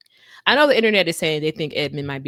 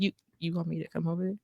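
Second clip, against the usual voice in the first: soft incredulous question; 9 dB quieter, faster than usual. Retold or imitated. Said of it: "She was like".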